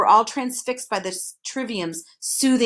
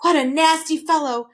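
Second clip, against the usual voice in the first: gasped; 6 semitones higher, 4 dB louder than usual. A narrator's reading. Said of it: "gasped Milo".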